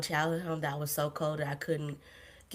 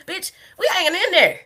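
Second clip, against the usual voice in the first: much higher and louder, a sassy shout-back; mock outraged retort; 14 semitones higher, 12 dB louder than usual. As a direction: throw it back at the coworker, loud and high. Retorts to coworker's quote.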